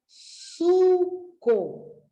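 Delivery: suco drawn out slowly; teacher slowly joining the syllables into suco; slow blended word. Shown as a demonstration. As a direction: stretch suco out to join su and co. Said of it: "para formar essa palavra suco".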